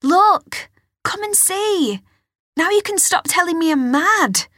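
high excited call across the garden; teen girl calling to her father, high and loud. A narrator's reading. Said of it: "Alice called to him from the French doors".

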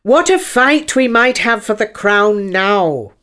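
hint at a fight with lofty relish; grand, scheming unicorn voice; sly unicorn. Acted.

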